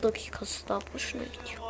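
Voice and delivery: breathy grumbling whisper. Real, not acted. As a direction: whisper the complaint to yourself. Muttered complaint while playing.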